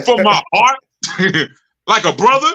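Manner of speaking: mock disbelief. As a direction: ham up the disbelief.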